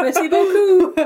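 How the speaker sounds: high, loud, laughing mock French; hammed-up French accent, laughing. Joking about speaking French.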